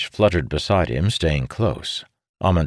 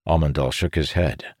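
ordinary delivery

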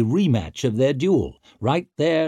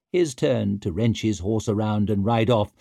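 plain reading voice

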